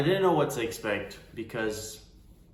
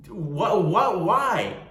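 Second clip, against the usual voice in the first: baffled what why; 5 semitones higher, 6 dB louder, much slower than usual. Retold or imitated. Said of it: "I was like".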